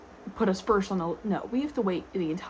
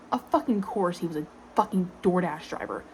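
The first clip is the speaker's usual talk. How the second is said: usual speaking voice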